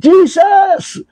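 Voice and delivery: high desperate cry of the name; shouting Jesus. Retold or imitated. Brother recalls shouting at the robber, high cry. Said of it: "Sumigaw ako".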